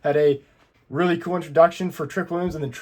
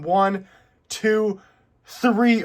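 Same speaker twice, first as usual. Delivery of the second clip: each count higher and more excited; rising pin count; 5 semitones higher, much slower than usual. Retold or imitated. Mimicking the referee's count as the giant power bomb lands, building excitement. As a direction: count the pin up to the big three.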